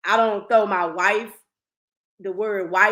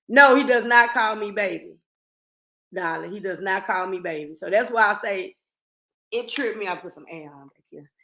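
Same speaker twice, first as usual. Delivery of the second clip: usual speaking voice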